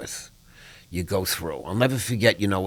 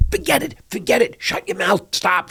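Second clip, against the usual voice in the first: much higher, panicked hushing; wife begging him to stop, pitched way up; frightened high urgent plea; 10 semitones higher than usual. Retold or imitated. Wife scared he'll lose his release, frantic high hushing. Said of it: "She's afraid".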